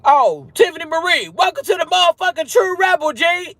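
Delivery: high-pitched, whooping greeting; excited shout-out, way up high; hyped welcome. Real, not acted. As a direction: greet them in a big, high sing-out.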